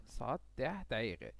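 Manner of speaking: ordinary talk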